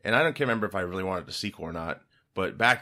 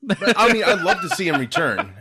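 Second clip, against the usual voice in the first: talking through big laughs; laughing; 9 semitones higher, 6 dB louder than usual. Real, not acted. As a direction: laugh while you say it. Laughing after the joke.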